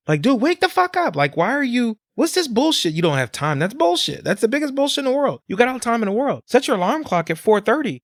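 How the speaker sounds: worked-up scolding rant; heated rant. Real, not acted. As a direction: voice climbing way up, worked up. Ranting at people without time.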